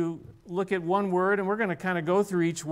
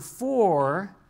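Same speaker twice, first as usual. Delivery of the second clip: "for" set apart, louder; stressed word; 3 semitones higher, 6 dB louder than usual. Shown as a demonstration. Pastor pointing the church to the word "for", stressed. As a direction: underline "for" by itself. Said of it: "starts with this word".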